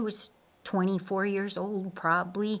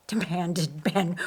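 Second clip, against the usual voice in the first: ordinary delivery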